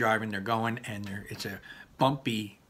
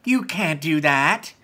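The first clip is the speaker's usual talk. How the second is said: indignant; 5 semitones higher, 7 dB louder, slower than usual. Acted. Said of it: "said Laurel".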